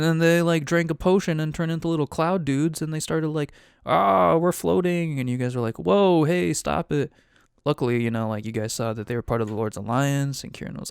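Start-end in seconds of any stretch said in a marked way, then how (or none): 3.8-5.2 higher, louder drawn-in imitation of cloud dudes; mock excited call as you float; mock floating cry. Game master voicing floating cloud dudes, mock excited cry. 5.8-7.1 louder, higher "whoa, hey" protest; mock alarmed call. Game master voicing players protesting at cloud dudes, mock alarm.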